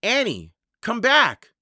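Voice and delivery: worried yell after someone; urgent shout. A narrator's reading. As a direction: shout after her to return. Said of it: "Jack shouted".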